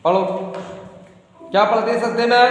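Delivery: ordinary delivery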